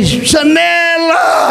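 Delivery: high, very slow drawn-out cry; joyful recognition. Retold or imitated. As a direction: cry the name out in happy surprise. Pastor recognizes old friend joyfully.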